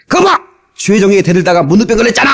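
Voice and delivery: sneering "see, you got leprosy!"; mock threatening. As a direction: play the bullying preacher, fast. Threatening pastors mocked.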